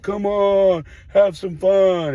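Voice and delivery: high, loud, drawn-out teasing call; teasing kids. Retold or imitated. Teammates in the dugout urging the coach, high teasing yell. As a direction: egg the coach on, kid-style. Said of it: "all the kids are like".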